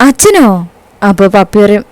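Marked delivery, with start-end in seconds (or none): 0.1-0.5 high, questioning burst of delighted disbelief; happy surprise. A narrator's reading. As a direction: ask it high, like a delighted child. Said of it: "മുഖത്ത് നല്ലൊരു സന്തോഷം". (സന്തോഷം കലർന്ന അത്ഭുതത്തോടെ)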